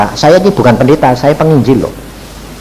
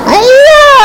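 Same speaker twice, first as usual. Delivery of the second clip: very high, smug "Sudah"; mocking high voice of the caller; 3 dB louder than usual. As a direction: toss off a cocky "done already" way up high. Caller answering, mocked.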